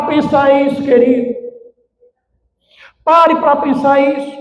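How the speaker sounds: high, projected pulpit cry; urgent exhortation to the congregation; impassioned preaching. Real, not acted. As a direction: preach at full force, pitch climbing.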